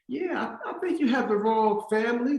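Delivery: high-pitched imitation of mom on the phone; politely disbelieving mom, voiced high; mother in denial. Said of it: "My mom was in denial".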